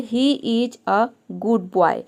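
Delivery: very slow, word by word; deliberate dictation pace; slow example reading. Shown as a demonstration. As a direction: read the sentence out slowly for the class. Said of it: "दूसरा sentence है".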